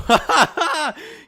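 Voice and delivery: real loud high laugh; loud laughter. Real, not acted. Friend reacts to the threat, loud high laugh.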